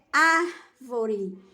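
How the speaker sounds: "árvore" slowly, with a long "ár" set apart; stress on ÁR. Shown as a demonstration. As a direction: hold the "ár" of "árvore", then finish the word. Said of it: "E a mais forte é".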